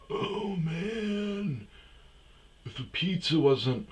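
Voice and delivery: high-pitched, put-on stoner voice; mock stoner. Mocking the old idea of pot smokers, high-pitched stoner voice. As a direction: send up a freshly high pothead.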